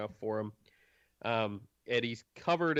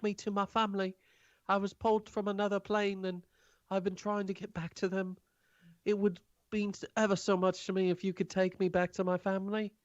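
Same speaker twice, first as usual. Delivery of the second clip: high, small timid voice; mouse person voice; 8 semitones higher than usual. Acted. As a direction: squeak it up like a shy mouse.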